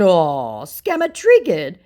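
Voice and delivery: high, loud, drawn-out tease; mocking taunt. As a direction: taunt the triggered scammer. Taunting the scammer.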